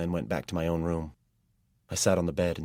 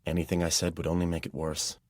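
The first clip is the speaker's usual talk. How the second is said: plain reading voice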